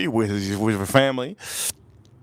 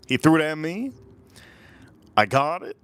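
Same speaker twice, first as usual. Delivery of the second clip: ordinary talk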